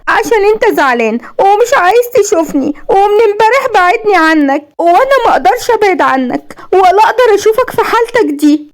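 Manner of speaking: high, tearful complaint; crying. A narrator's reading. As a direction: whimper it out high, as if in tears. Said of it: "بتعيطي ليه كده". (بعياط وصوت عالي الطبقة)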